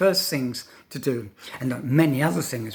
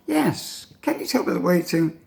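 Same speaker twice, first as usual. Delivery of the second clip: very high opening, swooping down; course book dialogue; 3 semitones higher than usual. Shown as a demonstration. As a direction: act the textbook tourist with exaggerated intonation. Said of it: "course book dialogues".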